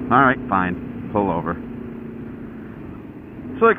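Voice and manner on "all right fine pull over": low, resigned retelling; mock resigned mumble to self; grudging compliance. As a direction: give in grudgingly, pitch sinking. Retold grudging compliance at traffic stop.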